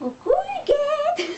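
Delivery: put-on very high, slow old lady voice; granny voice. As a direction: coo it like a sweet old granny. Greeting the granny character.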